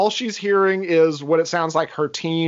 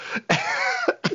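high laugh; 6 dB quieter than usual. Real, not acted. Laugh at scene.

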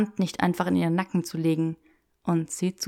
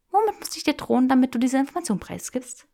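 higher, smiling threat; 6 semitones higher, faster than usual. Acted. Granger teasing Draco for information, smiling. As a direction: say it with a smile. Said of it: "Ein Lächeln lag in ihrer Stimme".

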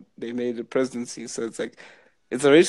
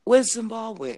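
put-on quoting the girl; 8 semitones higher than usual. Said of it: "She was like".

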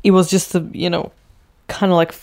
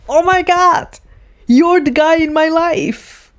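much higher, louder sudden realization; dramatic revelation; 10 semitones higher, 3 dB louder than usual. Retold or imitated. Suzanne realizing her son is the guy, much higher. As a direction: gasp it out like a revelation. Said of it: "she's like".

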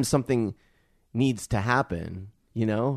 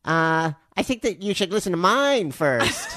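higher, slower take on her voice; imitating co-host's voice; 8 semitones higher, 3 dB louder than usual. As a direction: mimic her self-assured tone, slowly. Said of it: "say it in my voice".

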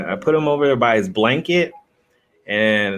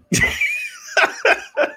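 laugh. Real, not acted. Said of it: "how many times Mister has bitten me".

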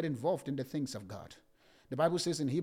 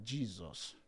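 muttered dismay; 7 semitones lower, 11 dB quieter than usual. Retold or imitated. Inner dismay at being recognized.

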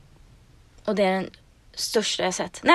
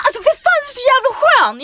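much higher, louder delighted outburst; hammed-up delighted guy voice; thrilled retold guy; 12 semitones higher, 5 dB louder than usual. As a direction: squeal it out, imitating his amazement. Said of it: "Han bara".

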